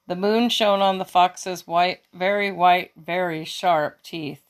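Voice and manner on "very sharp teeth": ordinary delivery, nothing marked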